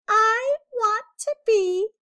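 about to make his wish; eager little mouse. A narrator's reading. Mouse Alexander answering the lizard, eager.

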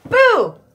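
loud high mock scary boo; playful boo. Teacher giving children a pretend scare with boo. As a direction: jump out with a fake scare.

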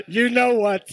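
loud, high, through real laughter; laughing. Real, not acted. Preacher repeating his parrot punchline to the room, laughing.

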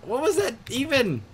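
high, loud, laughing through the shock; shaken laughing. Real, not acted. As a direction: still rattled, giggling. Startled after loud noise.

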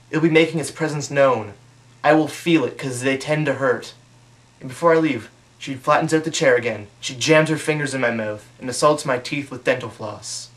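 ordinary talk, nothing marked

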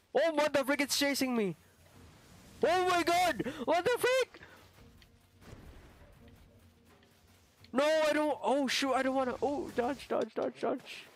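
high panicked yelps; scared horror game streamer. Real, not acted. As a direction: panic as it chases you. Pokemon chasing, panicking.

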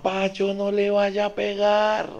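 high, slow pleading mother's voice. Retold or imitated. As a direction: beg him not to hit the boy. Mother begs father not to hit. (mamá suplicante, aguda y lenta)

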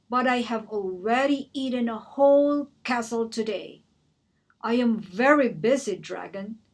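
booming, proud dragon voice; a busy dragon brushing off the princess; loud dragon. Acted. The dragon at the door, booming and dismissive.

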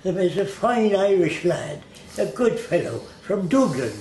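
a hint of a cheerful Dublin lad; mild mock Irish accent. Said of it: "then I met Irish kids, and they say".

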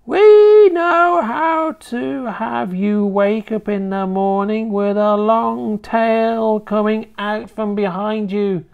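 high, loud, slow hag; menacing witch. A narrator's reading. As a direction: loom over him like a witch.

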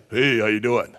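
low, menacing dad greeting; put-on low tough dad; protective daddy. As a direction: drop your voice like a father confronting someone. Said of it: "if you're a daddy".

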